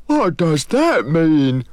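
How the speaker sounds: slow, high, baffled creature; not understanding a word; puzzled Moog. Acted. The Moog asking the genie, slow and puzzled.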